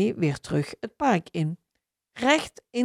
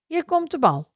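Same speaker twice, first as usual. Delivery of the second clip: a light, higher playful call; 9 semitones higher than usual. A narrator's reading. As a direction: call the ball across to a friend. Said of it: "roept Maya". (lichtjes roepend)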